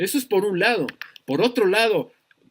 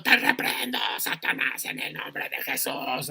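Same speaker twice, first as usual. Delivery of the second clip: mock shouted rebuke fading to a whisper; 4 semitones lower, 5 dB quieter than usual. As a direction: parody a rebuke that dies into a whisper. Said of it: "ya me los imagino ahí gritando". (burla de reprensión que se apaga en susurro)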